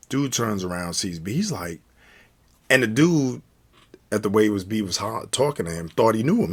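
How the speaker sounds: usual speaking voice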